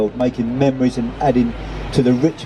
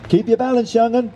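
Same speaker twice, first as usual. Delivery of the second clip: high-pitched amused call to a learner; laughing call; 11 semitones higher than usual. A narrator's reading. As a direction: call it out merrily, voice way up. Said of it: "laughed the seagull".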